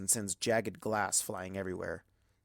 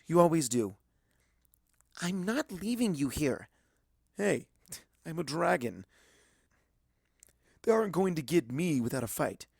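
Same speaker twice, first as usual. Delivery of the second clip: put-on higher young dragon voice, putting on brave bravado; a scared kid dragon acting tough, higher pitched; young dragon Spike; 6 semitones higher, 4 dB louder than usual. A narrator's reading. Young dragon telling Twilight to run, higher brave voice.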